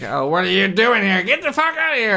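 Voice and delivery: high, loud furious shout; angry foreman yell. Acted. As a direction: bark them off the lot. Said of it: "He's like".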